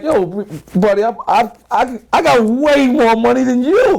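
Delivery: high-pitched, indignant boast to a cop; flossing brag. Retold or imitated. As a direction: throw your money in his face, pitched high. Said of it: "like, really floss".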